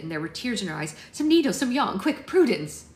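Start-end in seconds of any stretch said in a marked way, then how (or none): 1.1-2.8 high, loud, urgent orders; commanding. A narrator's reading. Blessing hurries Prudence to save Maud, commanding. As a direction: fire off each item as an order. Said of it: "she commanded".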